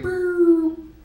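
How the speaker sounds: high, loud siren whoop; recorded cop message starting with a loud siren whoop; police siren. Pretend. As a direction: mimic a cop car siren. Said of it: "They'll be like".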